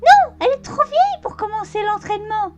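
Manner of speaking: mock squeaky colt voice; thin squeaky voice. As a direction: fake a guttural voice and fail into a squeak. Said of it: "voix de merde fluette".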